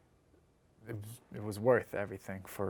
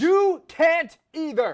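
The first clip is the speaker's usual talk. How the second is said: shouting; 15 dB louder, slower than usual. Acted. Shouting at partner in fight.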